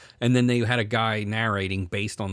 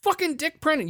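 high-pitched exasperated outburst; retold yelling. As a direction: re-enact a heated rant, voice way up. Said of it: "I'm yelling her".

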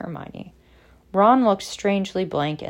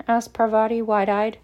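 plain reading voice

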